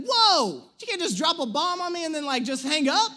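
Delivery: mock-outraged protest starting on a shout; alarmed protest. Patient to nurse about to hang up, alarmed protest.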